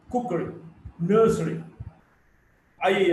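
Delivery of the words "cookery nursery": COOK-ery, NURS-ery, endings light; first-syllable stress. Shown as a demonstration. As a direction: punch the openings of "Cookery" and "nursery". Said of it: "is on the first syllable".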